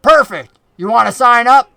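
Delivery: loud, eager southern goblin pitch; Finch, southern accent. Acted. Goblin recruiting his new friend, loud southern-accented eagerness. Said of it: "the goblin from the north with the southern accent".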